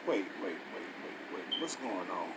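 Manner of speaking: hushed confusion. Real, not acted. Finds verse not matching.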